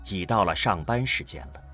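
ordinary talk

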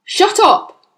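loud, high-pitched angry command; father's sharp yell; shouted. Acted. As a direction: shout down a child. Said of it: "the father shouted".

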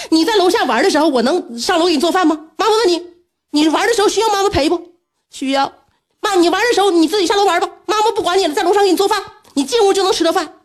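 high-pitched exasperated questioning; retold yelling. Mother re-enacting an argument with her son, high and exasperated. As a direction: reason with a child in high, mock exasperation. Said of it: "就跟他喊".

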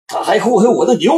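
high, loud bellow; roaring. Acted. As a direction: roar it out in rage. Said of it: "刘毅吼了一声".

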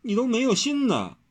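high, wounded whine; lightly acted whimpering complaint; mock-tearful whimper. A narrator's reading. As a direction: reproach him in a tearful high voice. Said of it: "又抽抽搭搭地呜咽着道".

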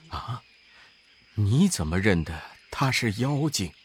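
put-on low, soft voice of an old monk; gentle monk. A narrator's reading. Master asks his disciple for food, low and soft. Tang Seng voiced in story.

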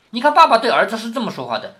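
ordinary talk

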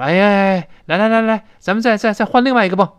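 rally your partner to have another go; optimistic husband. Acted. Husband urging wife to try another pump, upbeat. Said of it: "老公倒很乐观".

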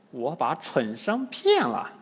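ordinary delivery

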